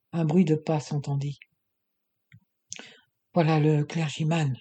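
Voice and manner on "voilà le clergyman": plain reading voice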